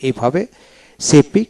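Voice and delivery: normal talking voice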